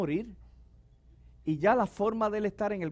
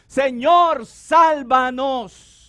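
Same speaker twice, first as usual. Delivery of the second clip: loud, higher, very slow cry for help; drawn-out shouted plea, higher; crowd's cry; 6 semitones higher, 14 dB louder, much slower than usual. Retold or imitated. Voicing crowd crying to Jesus.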